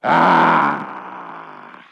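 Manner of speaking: low mock angry growl. Shown as a demonstration. Pastor showing an angry noise, low growl. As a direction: growl like a grumpy man. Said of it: "What does an angry noise sound like".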